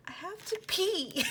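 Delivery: high, drawn-out plea; pleading. Retold or imitated. Explaining to him why she came back in, pleading.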